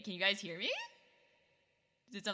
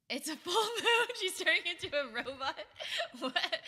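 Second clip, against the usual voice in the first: laughing; 9 semitones higher, 3 dB louder than usual. Real, not acted. Laughing at the robot joke.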